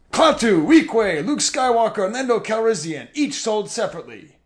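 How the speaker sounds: high, booming toy ad announcer; voice of God. Acted. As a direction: boom it out like a commercial narrator. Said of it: "the voice of God tells us".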